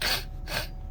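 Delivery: mock sniff. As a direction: sniff hard like a stuffy nose. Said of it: "I'm coming to work going".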